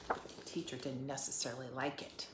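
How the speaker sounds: plain reading voice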